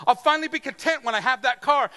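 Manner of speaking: high, loud, fast eager promise; eager hope. Retold or imitated. Preacher mimics a job seeker, eagerly loud. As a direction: sell the dream of the job to yourself. Imagined eager car buyer.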